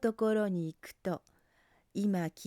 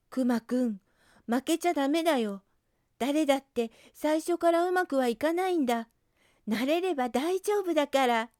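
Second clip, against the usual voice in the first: higher, louder rallying cry; urgent encouragement; 5 semitones higher, 5 dB louder than usual. Acted. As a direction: push the bear not to give up. Rabbit urgently encourages bear. (必死に励ますうさぎ)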